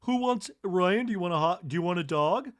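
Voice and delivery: cheerful barbecue offer; mock hostess. Imagined Kathy offering hot dogs to Ryan. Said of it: "if Kathy was like".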